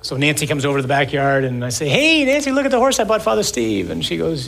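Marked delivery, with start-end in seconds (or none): 1.9-3.9 higher and louder, showing off the horse; proud calling out. Retold or imitated. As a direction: call over to Nancy, bursting with pride.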